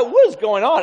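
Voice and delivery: loud, high bewildered cry; alarmed disbelief. Retold or imitated. Realizing the trap, he cries out in loud, high alarm. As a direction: blurt it out in shocked confusion. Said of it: "I'm like".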